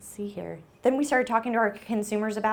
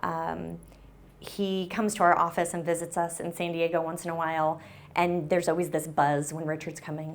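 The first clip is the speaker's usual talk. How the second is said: ordinary delivery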